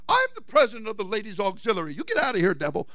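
quick, hammed-up pompous boast; mock self-important president. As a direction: puff up the title mockingly. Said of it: "people that are so position oriented".

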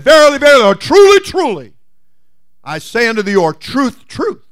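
loud, high ringing declaration; forceful proclamation. Acted. Jesus answers with force.

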